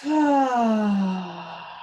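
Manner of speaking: drawn-out falling "ah" sigh; long expressive sigh. Shown as a demonstration. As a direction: breathe out audibly through the mouth. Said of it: "make it long, expressive".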